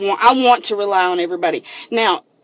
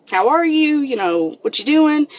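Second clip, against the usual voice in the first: caring friend; 7 semitones higher than usual. Retold or imitated. Friend Brittany texting, caring.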